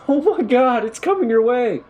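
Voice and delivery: very high, loud terrified scream; panicked scream. A narrator's reading. Sobbing man screaming a warning, terrified. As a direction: warn them in panic. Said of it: "a sobbing man broke the silence".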